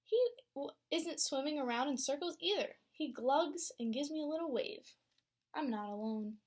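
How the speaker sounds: lively read-aloud storytelling voice; story narration. A narrator's reading. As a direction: read it to a class of young kids. Teacher reads picture book aloud.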